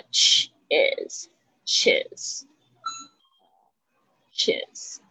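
whispered "chis，chis" for the ending. Shown as a demonstration. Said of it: "它是is的结尾". (轻声慢念"chis")

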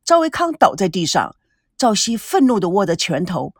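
usual speaking voice

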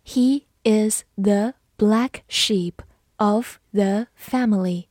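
slow, word-by-word reading; deliberate teacher pace, pausing between words; slow model reading. Shown as a demonstration. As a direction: space out each word for learners. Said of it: "好，慢慢来读".

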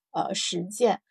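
ordinary talk

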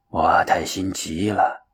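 slow, soft, low elder monk; old master, grave. A narrator's reading. Master telling disciple he has not awakened, grave. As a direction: counsel gently and deliberately, pitched well down.

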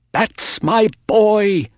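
high, drawn-out cry of delight; high-pitched racegoer's cheer; excited cheer. A narrator's reading. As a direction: cheer on your horse, proudly and slowly. Character cheers on his horse in race.

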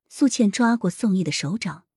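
ordinary delivery

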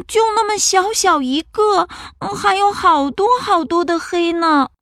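high small cub voice; timid little bear. Acted. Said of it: "小小熊说".

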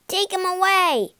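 high, loud frightened yell. A narrator's reading. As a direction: yell for rescue. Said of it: "yelled Norm".

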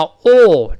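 loud, long rounded "o", like aw; British long vowel. Shown as a demonstration. Teacher shows British vowel, "o" loud, long and rounded. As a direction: hold "o" long with rounded lips. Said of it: "这个长音".

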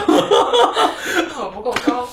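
very slow, loud, laughing protest; laughing at herself. Real, not acted. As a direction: drag it out laughing. Laughing at teasing about defending.

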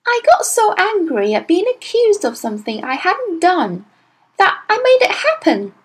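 put-on high, earnest child's voice pleading innocence; a small girl anxious to be believed; little girl Matilda. A narrator's reading. Matilda's lines in the story.

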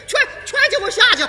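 rising to a high, loud order, laughing through it; old mother of the warlord losing patience and shouting; angry old lady. Acted.

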